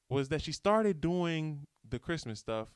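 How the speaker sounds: ordinary talk, nothing marked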